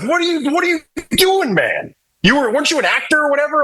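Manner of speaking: very high, incredulous retort; mock exasperation. Bandmates coaching a stiff actor, high mock exasperation. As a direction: pitch way up as if baffled by him.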